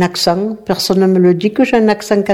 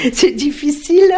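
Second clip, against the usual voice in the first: higher voice between laughs; laughing; 6 semitones higher than usual. Real, not acted. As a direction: say it through amused laughter. Laughs about not understanding him. (en riant, voix plus aiguë)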